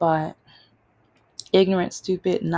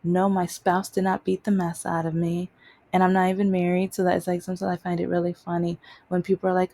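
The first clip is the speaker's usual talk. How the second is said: normal talking voice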